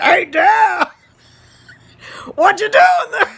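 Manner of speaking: high, loud mocking call; teasing shout. Son greeting dad on crutches, loud teasing call. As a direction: holler to tease dad. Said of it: "I said".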